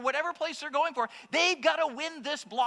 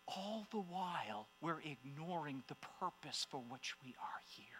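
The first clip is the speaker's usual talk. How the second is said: hushed; 8 semitones lower, 15 dB quieter than usual. Real, not acted. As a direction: drop to a whisper to draw them in. Preacher drops to a hush.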